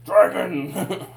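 high laughing shout of Dragon; mock scream. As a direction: yell it out, half laughing. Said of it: "be all like".

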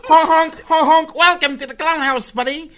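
high, loud honking clown voice; silly clown. Pretend. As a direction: play a goofy circus clown, honking. Joking about clown world.

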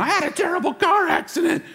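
high-pitched, hammed-up cry of alarm; mock wailing. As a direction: exaggerate it as an endless lament. Mimics someone reliving accident daily.

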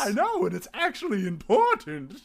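silly outro host; outro man voice. Pretend. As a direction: huff like a pompous host. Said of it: "outro man".

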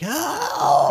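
imagined shout for help she never gave; mock cry for help. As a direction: throw out a hammed-up high cry for help. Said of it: "if I'd been like".

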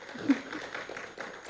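quiet unvoiced laugh under her breath; soft nervous laugh. Real, not acted. Nervous speaker laughing at herself before the church, soft laugh. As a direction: let a shy laugh slip out.

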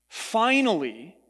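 finally drawn out slowly with a rising tune; emphasis on finally. Shown as a demonstration. Pastor to congregation, slow stressed finally. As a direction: linger on finally to make it count. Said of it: "I emphasize that word for a reason".

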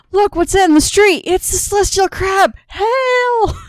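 high, loud hammed-up amazement; playful high-pitched crowd acclaim; mock awed onlooker. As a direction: cry out in mock wonder, ending on a shouted hail. Acting out onlookers hailing the crab.

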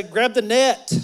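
loud rising call of Grab the net, net lifted up at the end; call to the neighbor. Shown as a demonstration. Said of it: "Turn to your neighbor and say".

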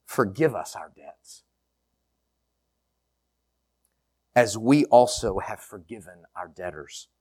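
hushed, slow, long pauses after each line; slow prayer reading. Shown as a demonstration. Pastor slowly reading the Lord's Prayer to the congregation, hushed. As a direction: pray it word by word, fading to a whisper at the end. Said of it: "I'm gonna read it slow. I'm gonna pause after each line".

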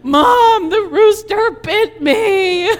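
put-on child crying to mom, high and loud; sobbing cry. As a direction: wail it like a bitten, sobbing little girl. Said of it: "And I'm sobbing".

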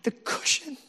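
very high, hammed-up wail of hurt; mock whining outburst. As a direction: replay a petty argument, building to loud. Acts out himself in marital argument.

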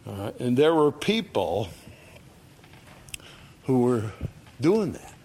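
normal talking voice

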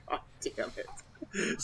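slow, breathy, under the breath; exasperated mutter. Real, not acted. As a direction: grumble it out slowly. Annoyed at candy on webcam.

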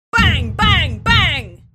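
very loud, high imitation of banging; shouted banging. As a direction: bellow the bangs, each starting high and falling. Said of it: "the dwarf goes".

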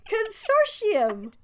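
Consortium drawn out slow, high and loud, with SH; corrective pronunciation. Shown as a demonstration. Amused correction to the co-host, corrective pronunciation. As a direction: say Consortium slowly as "con-SOR-shee-um". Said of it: "How do you say it?".

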